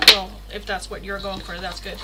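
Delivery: ordinary talk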